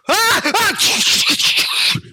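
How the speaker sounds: two high screams; high crash scream. Acted. Bird crashing into a house, two high screams. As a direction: shriek as she smashes through glass.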